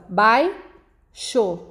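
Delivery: "baixo" as bai... xo; long pause between bai and xo; split into syllables. Shown as a demonstration. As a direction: break "baixo" into two beats. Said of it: "Vamos fazer a separação".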